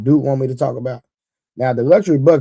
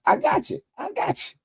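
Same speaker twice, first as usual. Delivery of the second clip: squeaky high voice; 6 dB quieter than usual. Acted. Playful high-pitched reassurance to chat.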